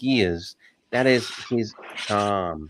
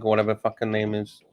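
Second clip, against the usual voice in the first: normal talking voice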